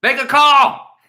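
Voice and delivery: loud, high imitation of someone demanding a call; shouted impression. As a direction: bark the order as someone else. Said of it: "who knows who I'm trying to imitate".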